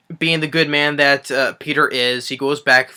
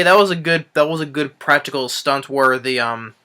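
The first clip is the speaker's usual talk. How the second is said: ordinary talk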